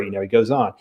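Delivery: ordinary talk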